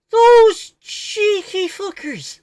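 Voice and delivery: loud, high, drawn-out Irish outburst; Irish accent. Acted. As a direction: shout it in a hammed-up Irish accent. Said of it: "Whenever I do an Irish accent".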